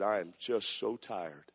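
slow, soft, worn-out plea; tired. Retold or imitated. Preacher telling Brother Bulkley he is exhausted, slow weary sigh of a voice. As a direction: sag into it, drained and slow. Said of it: "I was tired".

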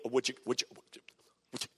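low, soft stutter fading to nothing; imitating a tongue-tied stutterer; stammering. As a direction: stammer helplessly while asking someone out. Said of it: "I stuttered, I stammered".

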